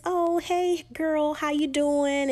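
high-pitched, drawn-out mock-friendly hello; awkward friendly greeting.

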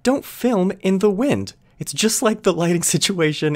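high, slow, exasperated. Real, not acted. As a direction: spell it out slowly in disbelief. Exasperated reaction rant.

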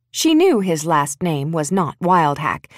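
ordinary delivery

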